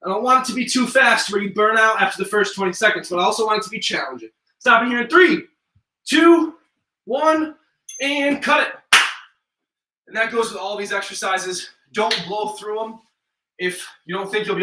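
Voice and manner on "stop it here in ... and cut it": loud countdown. Real, not acted. Coach to class ending the set: loud called countdown. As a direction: call the count out high and loud, cut slowed right down.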